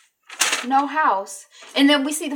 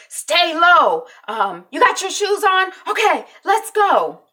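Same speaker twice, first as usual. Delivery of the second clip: raised, high acted urgency; urgent instructions; 8 semitones higher, 5 dB louder, slower than usual. Jack directing Randall out of the fire, urgent. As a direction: imitate rallying someone to escape.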